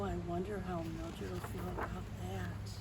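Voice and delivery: ordinary delivery, nothing marked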